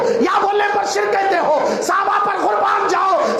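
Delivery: fiery preacher calling out rivals; preaching at full force. Acted. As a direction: rebuke the crowd from the pulpit. Preacher harangues opposing sects.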